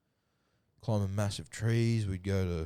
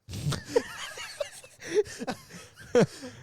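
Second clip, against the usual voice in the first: high laugh. Real, not acted. Big laugh after Putin joke.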